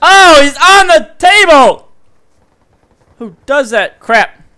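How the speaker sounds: slow, high, loud disbelief; incredulous shout. Real, not acted. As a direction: yell it in disbelief, slowly. Enemy standing on a table.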